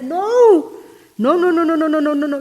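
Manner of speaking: a high, loud run of no's, retold; loud refusal. Refusing the boyfriend's proposal, a retold loud no. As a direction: shout him down as he tries to propose.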